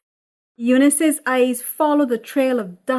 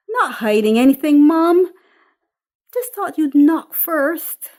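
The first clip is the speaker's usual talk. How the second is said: louder, higher, defensive protest; annoyed daughter; 7 semitones higher, 10 dB louder than usual. Acted. Daughter caught off guard by her mother, loud and defensive. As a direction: push back at a nosy mum.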